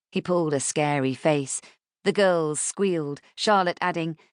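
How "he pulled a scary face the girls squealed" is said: usual speaking voice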